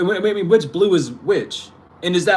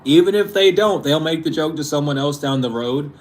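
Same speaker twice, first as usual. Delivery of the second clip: ordinary delivery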